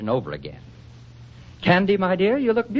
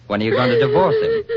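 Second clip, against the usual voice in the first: tearful; 5 dB louder, faster than usual. Acted. Tearful wife pleads through crying.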